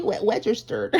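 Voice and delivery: put-on soft baby-talk "registered" with w sound. Shown as a demonstration. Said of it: "I don't know, a baby".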